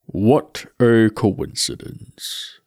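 slow, grave skeleton voice; Death's voice. Acted. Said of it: "said the skull".

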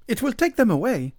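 high, loud peasant insistence; stubbornly insistent. A narrator's reading. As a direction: maintain it against all arguments. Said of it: "the peasant maintained against all arguments".

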